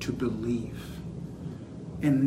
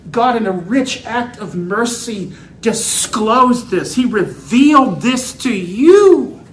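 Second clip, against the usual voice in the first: loud, high, drawn-out proclamation; emphatic preaching climax; 7 semitones higher, 10 dB louder than usual. Real, not acted. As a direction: build to a slow, ringing shout. Preaches at full force.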